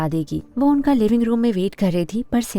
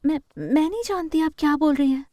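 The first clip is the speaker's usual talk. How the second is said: higher-pitched, stammering protest; nervous denial; 6 semitones higher than usual. Acted. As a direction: stumble into a bewildered denial. Accused Sally stammers in denial. (घबराकर हकलाते हुए)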